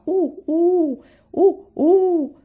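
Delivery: loud, high hooting owl call. Acted. Owl calling from the trees by the creek, hooting. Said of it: "un gufo cantava".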